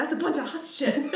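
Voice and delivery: laughing mock Maine grandma on huss shit; imitating grandma. Imitating grandma calling it huss shit, laughing. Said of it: "she's like".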